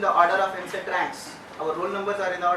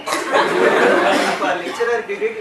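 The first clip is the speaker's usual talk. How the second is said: laughing; 9 dB louder, much slower than usual. Real, not acted. Mocking the lecturer's authority.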